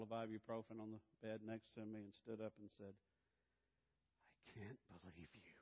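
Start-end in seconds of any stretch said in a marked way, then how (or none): 4.5-5.5 low, soft disbelief; lightly voiced wife. Wife stunned at her husband, low soft disbelief.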